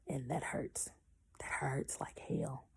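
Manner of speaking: slow pained whisper; whispered, still hurting. Real, not acted. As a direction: breathe it out slowly. Recalling painful dry birth.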